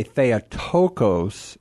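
"Theotokos" said very slowly, every syllable clear; slow teaching model of "Theotokos"; careful pronunciation. Shown as a demonstration. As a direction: spell out "Theotokos" by sound, stressing the omicron. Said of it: "the accent there on that omicron".

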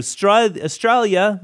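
high-pitched, badly faked Australian accent; hammed-up attempt at an Australian accent; terrible Australian accent. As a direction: have a clumsy go at an Aussie accent. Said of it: "I'm doing a terrible accent".

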